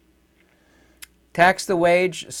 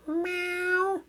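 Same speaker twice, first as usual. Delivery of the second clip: very high little cat meow; mock kitten meow; 4 dB quieter than usual. Vlogger mocking declawed humanity with a very high kitten meow. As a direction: meow like a tamed kitty. Passive kitty cats.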